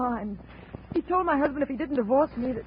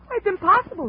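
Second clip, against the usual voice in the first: desperate outburst; 9 semitones higher, 7 dB louder than usual. Acted. Widow denies Donovan killed husband.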